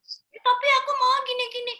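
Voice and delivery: put-on protesting toddler, high and pushy; squeaky child insisting on her way; imitating her toddler. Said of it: "kalau anak saya bilang".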